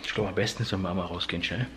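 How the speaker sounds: hushed, so the wife can sleep; whispering on the phone. Real, not acted. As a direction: keep it down, quiet voice. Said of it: "Ich muss leise sprechen".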